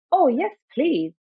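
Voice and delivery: put-on eager child saying yes; enthusiastic. A narrator's reading. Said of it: "Ada replied with enthusiasm".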